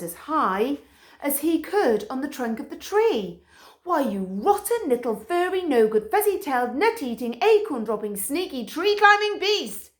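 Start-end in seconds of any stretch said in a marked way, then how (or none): 3.8-9.9 louder, higher furious tirade; loud furious dog rant; yelling. A narrator's reading. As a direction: rant up at the squirrel. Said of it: "he yelled".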